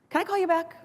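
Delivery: stalled and flustered, buying time; startled. Retold or imitated.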